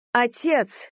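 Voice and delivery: high call across the hall. Acted. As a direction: call out to father. Daughter calls out to father.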